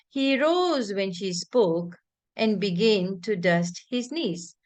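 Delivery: slow, with a little pause after spoke; teacher marking the comma with a short break. Shown as a demonstration. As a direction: pause briefly at the comma, don't stop. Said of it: "There is a little pause".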